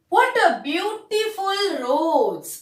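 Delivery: slow, marvelling read of "What a beautiful rose"; exclamatory wonder. Shown as a demonstration. Teacher shows pupils how an exclamation sounds, slow and marvelling. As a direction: let "beautiful" stretch out in amazement. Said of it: "देखिए मैं ये कैसे पढ़ती हूँ".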